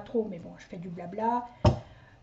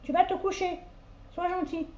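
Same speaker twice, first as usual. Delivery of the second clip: talking to dog; 6 semitones higher than usual. Real, not acted. Owner telling the dog to lie down, higher-pitched coaxing. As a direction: coax a pet to settle down.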